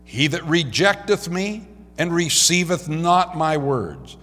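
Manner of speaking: measured, emphatic pulpit reading; scripture reading. Acted. Preacher reads Jesus' words to the congregation, emphatic scripture reading. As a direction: read it out firmly to a congregation.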